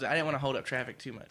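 ordinary delivery

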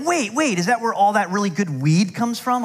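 high, eager mock friend asking; excited disbelief. Friends on the subway asking about the weed, excited and high.

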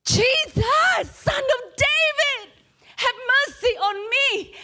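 high, loud cry of a blind beggar; desperate shout. Acted. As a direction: call out to Jesus with everything you have. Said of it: "he shouted out voluntarily".

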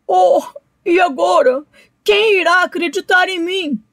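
crying to himself, who will believe me; despairing alligator. A narrator's reading. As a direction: fret tearfully as you get swept away.

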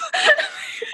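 high burst of laughter. Real, not acted. Telling her friend about the boss, high laugh.

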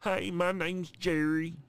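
deliberately drawn-out, playful cartoon-dad voice; Cleveland voice. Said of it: "Cleveland from Family Guy?".

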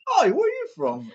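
high-pitched imagined stranger; curious passer-by. Pretend. Imagined stranger questions the chanter, high put-on curiosity. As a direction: pipe it up like a nosy onlooker. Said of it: "someone would be like".

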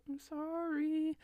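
high, slow little apology; sheepish apology. Real, not acted. Apologizes for forgetting the god.

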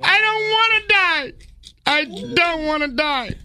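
high hammed-up sobbing appeal; desperate pleading. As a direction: beg for your life in a high wail.

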